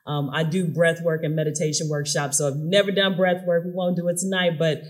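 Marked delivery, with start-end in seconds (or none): none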